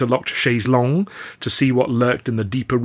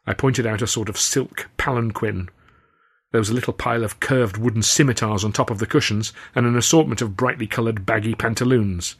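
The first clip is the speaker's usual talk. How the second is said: plain reading voice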